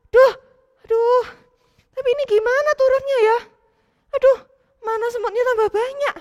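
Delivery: put-on high child voice, fretting and unsure; a kid talking himself into climbing down; scared, cross boy. Matthew alone in the tree, scared and cross.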